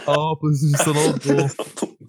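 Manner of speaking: slow, altered mimicry of a friend; mocking impression. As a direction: drag it out, mock his voice. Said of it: "your very bad impression of him".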